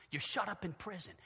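normal talking voice

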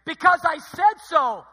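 high, loud mock stern mom; stern mother voice. Preacher imitating his mother scolding, loud and high. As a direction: bark it like an exasperated mother.

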